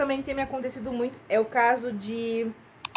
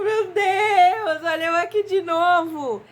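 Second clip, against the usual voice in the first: higher and louder, drawn-out "there I go again"; exasperated at herself; 6 semitones higher, 6 dB louder, much slower than usual. Retold or imitated. Pregnant wife scolds herself after snapping at husband, exasperated. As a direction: groan at herself in mock despair, slowly.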